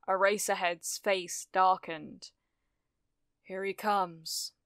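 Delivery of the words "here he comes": slow, soft, foreboding; grim warning. A narrator's reading. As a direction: warn softly and slowly. Eraserhead grimly awaits the explosion.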